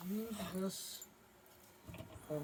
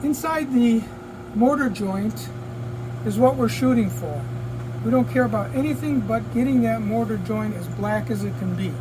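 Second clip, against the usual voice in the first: louder, raised voice over the fan noise; talking over fan; 3 semitones higher, 8 dB louder than usual. Real, not acted. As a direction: project to be heard above the booth fan. Talking over paint booth fan.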